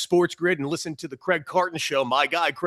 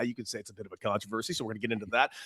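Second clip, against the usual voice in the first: ordinary delivery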